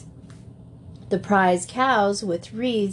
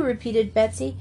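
plain reading voice

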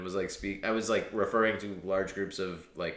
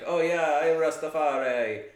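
loud, higher attempt at a Jamaican accent; bad Jamaican accent; 7 semitones higher, 6 dB louder than usual. Shown as a demonstration. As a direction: put on a clumsy Jamaican accent. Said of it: "I will do it badly".

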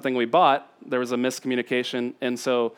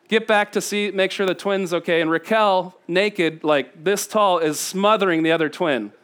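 normal talking voice

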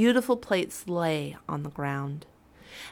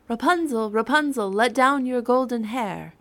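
higher, louder call up to the tower; 5 semitones higher, 5 dB louder than usual. A narrator's reading. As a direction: call up to her window. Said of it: "called out".

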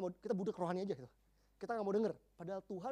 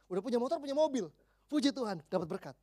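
ordinary delivery

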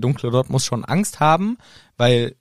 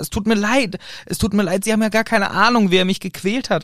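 put-on high, anguished pleading old man; pleading apology; 8 semitones higher than usual. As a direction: beg for forgiveness, voice raised. Ollivander pleading, voiced. (flehende Entschuldigung)

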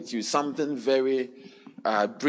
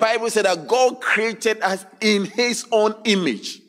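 loud, raised preacher's voice; preaching at full force; 7 semitones higher, 4 dB louder than usual. Acted. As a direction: drive the verse home to the crowd. Preaching the verse again.

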